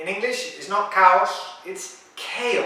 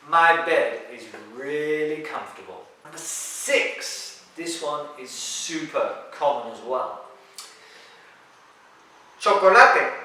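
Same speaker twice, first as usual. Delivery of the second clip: ordinary talk, nothing marked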